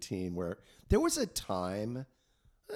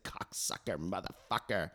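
parrot voice; 6 dB quieter than usual. Retold or imitated. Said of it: "These birds said".

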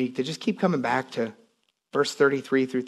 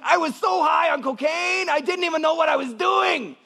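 imitating Pancho Juarez; 14 semitones higher than usual. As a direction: yell it like the wild ex-addict in his story. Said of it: "I'm just acting like him".

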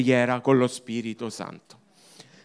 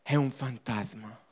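lower, softer repeat with a rise; soft echo; 5 semitones lower, 9 dB quieter than usual. Shown as a demonstration. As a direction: let it fade as a doubtful echo. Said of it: "Non lo riconosciamo". (ripetizione sommessa, più bassa e piano)

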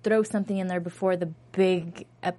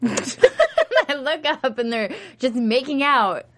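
laughing; 6 semitones higher, 4 dB louder than usual. Real, not acted. Laughing while retelling.

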